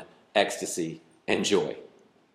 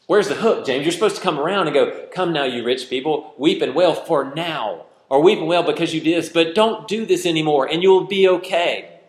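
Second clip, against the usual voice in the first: exasperated inner voice; 9 semitones higher, 5 dB louder than usual. Retold or imitated. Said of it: "I'm going".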